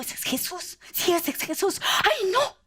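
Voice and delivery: breathless, rising excitement, high; hushed at first, then a high cry; excited gasp. Acted. Blind beggar realizing Jesus passes, excited.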